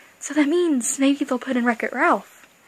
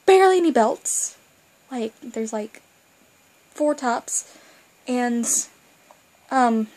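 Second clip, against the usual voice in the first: ordinary talk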